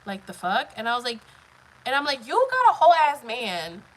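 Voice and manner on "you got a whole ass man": loud, raised-pitch indignant quote; retold confrontation. Telling the other woman she's got a man, indignant. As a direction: retell telling her off, voice raised.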